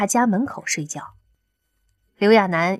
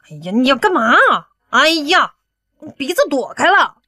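grossed-out, raised-pitch protest; disgusted 刘亚楠; 6 semitones higher, 4 dB louder than usual. A narrator's reading. 刘亚楠 dodging a man who sniffs him, disgusted. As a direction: fend someone off, higher and louder in disgust. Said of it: "刘亚楠被他恶心得够呛".

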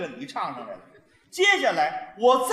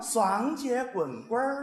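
Sichuan style; 4 dB quieter, much slower than usual. Shown as a demonstration. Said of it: "那双节棍呢".

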